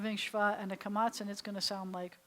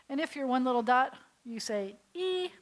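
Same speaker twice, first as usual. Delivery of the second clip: normal talking voice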